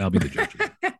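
squeaky high, laughing through it; playful high mock voice. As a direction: toss it off in a silly high voice. Repeats the line laughing, high.

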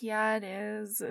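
soft, slow, drawn-out answer; acted weary agreement, hushed; subdued reply. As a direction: answer small and slowly. Her weary sleepy reply.

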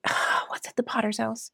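sighing, soft, exasperated question; frustrated. Acted. Frustrated prophet questioning the Lord's errand, exasperated. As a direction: let out a weary huff as you ask. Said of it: "I was frustrated already".

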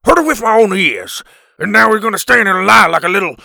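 loud, fast, high caveman accusation; angry caveman. A narrator's reading. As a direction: accuse him furiously.

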